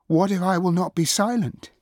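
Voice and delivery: high, loud challenge; defiant outburst. A narrator's reading. Princess defies the goddess, shrill outburst. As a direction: throw the threat out at full pitch.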